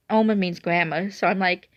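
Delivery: ordinary delivery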